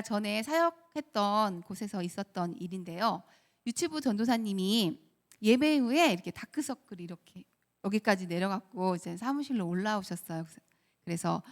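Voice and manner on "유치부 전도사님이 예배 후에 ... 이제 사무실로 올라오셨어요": normal talking voice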